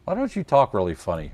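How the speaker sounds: ordinary talk, nothing marked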